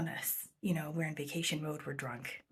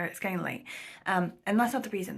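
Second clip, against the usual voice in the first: ordinary delivery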